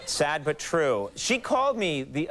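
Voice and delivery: ordinary talk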